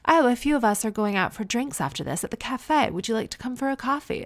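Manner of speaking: high-pitched mimicry of posh transatlantic twang; posh American, British twang. One mom invites another, imitated posh British twang. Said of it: "posh American with that British twang".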